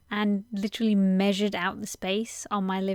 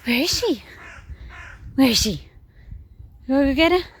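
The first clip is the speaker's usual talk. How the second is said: calling out; 4 semitones higher, 5 dB louder, faster than usual. Real, not acted. Calling for the dog.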